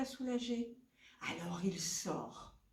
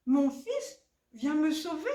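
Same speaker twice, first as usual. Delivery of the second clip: high, loud echoed cry; calling for help; 7 semitones higher, 7 dB louder than usual. Acted. Son hears mother's plea through the hut, calling. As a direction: call out on a raised pitch.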